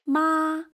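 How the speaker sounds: "妈" held high and level, like a sung note; high flat tone. Shown as a demonstration. Teacher demonstrating first tone, high and flat. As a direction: hold "妈" high, loud and steady. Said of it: "Your voice stays high and flat".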